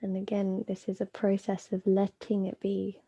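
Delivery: calm, unhurried yoga nidra guidance with long pauses; measured meditation voice, phrases spaced out; guided meditation. Acted. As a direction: guide them inward, leaving space between phrases.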